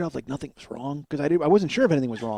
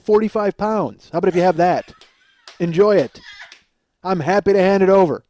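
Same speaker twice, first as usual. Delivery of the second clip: loud, higher, emphatic riff; mock defiant offer; 5 semitones higher, 7 dB louder than usual. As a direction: hand it over with loud bravado. Mock offer to anyone suing him.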